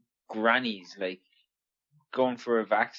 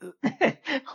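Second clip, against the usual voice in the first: high laugh; 13 semitones higher than usual. Real, not acted. Laughing at the quote.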